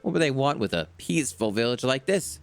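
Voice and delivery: put-on a wise old man challenging the youngster; old islander.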